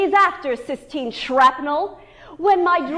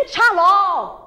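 irritated; 4 semitones higher, 4 dB louder than usual. Retold or imitated. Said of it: "nuances of irritation".

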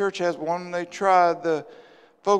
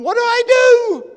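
high, loud imitated wail; hammed-up desperate cry; mock weeping wail; 13 semitones higher, 8 dB louder than usual. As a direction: wail it out, imitating someone in tears. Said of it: "they're crying, and they're weeping".

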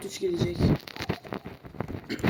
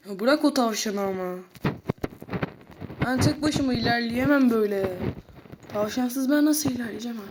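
normal talking voice

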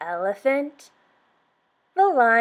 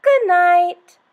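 higher and much louder call; loud lion good night; 8 semitones higher, 9 dB louder than usual. Acted. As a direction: boom it out like a lion. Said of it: "The lion said".

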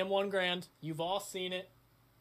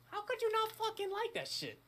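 much higher excited squeal; excited outburst; 3 dB quieter than usual. Real, not acted. Raving about his Garand, much higher excited squeal. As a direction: gush like a fan who can't believe it.

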